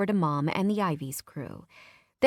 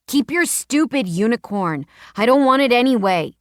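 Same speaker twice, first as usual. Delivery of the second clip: put-on loud childish outburst; a sulking boy shouting; angry boy yelling; 5 semitones higher, 9 dB louder than usual. A narrator's reading. Boy angrily throwing back pin.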